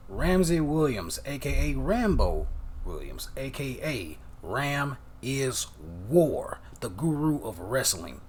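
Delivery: drawn-out, punched-up wrestler introduction; ring announcer introduction. Pretend. Host introducing the guru of wrestling, mock ring announcer. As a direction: announce each name big, with pauses.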